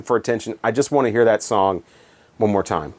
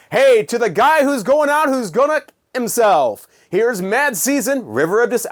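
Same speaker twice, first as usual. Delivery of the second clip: higher, slowed, hammed-up radio announcer; cheesy DJ voice; 9 semitones higher than usual. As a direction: oversell it like a corny late-night DJ. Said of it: "just doing the cheesy D J voice".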